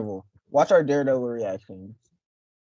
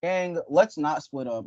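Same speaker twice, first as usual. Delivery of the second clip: normal talking voice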